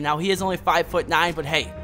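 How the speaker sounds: ordinary talk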